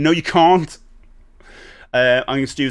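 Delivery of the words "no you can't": loud sharp "No, you can't"; firm refusal. Acted. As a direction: shut it down loudly.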